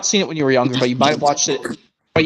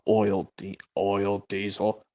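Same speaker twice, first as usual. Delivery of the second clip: slow, low, soft Diesel voice; Diesel impression; 7 semitones lower, 9 dB quieter, slower than usual. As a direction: drawl it out as the sneaky engine Diesel. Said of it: "Brandon or Angelis' Diesel impression".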